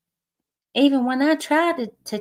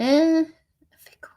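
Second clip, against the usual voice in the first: whispered fickle; 6 semitones higher, 3 dB louder than usual. Shown as a demonstration. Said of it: "Which one you like better?".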